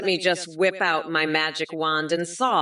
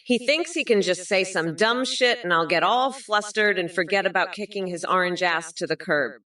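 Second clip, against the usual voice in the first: ordinary delivery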